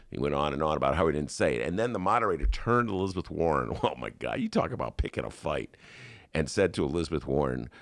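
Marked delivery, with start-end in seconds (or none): none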